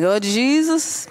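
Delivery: slow, drawn-out "Oh, Jesus"; deflated sigh. Retold or imitated. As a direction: sigh it out like someone caught out. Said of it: "I would have been like".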